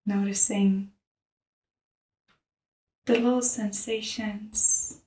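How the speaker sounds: soft, hushed meditation voice; softly led breathing practice; guided meditation. Real, not acted. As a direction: guide them gently into the breath, with long pauses.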